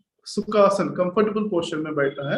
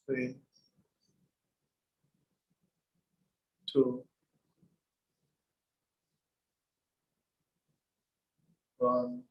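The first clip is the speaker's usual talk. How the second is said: hushed, softer count with long gaps; soft countdown; 3 semitones lower, 7 dB quieter, much slower than usual. Real, not acted. Teacher closing a minute of breath meditation, soft countdown. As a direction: count down gently without disturbing the silence.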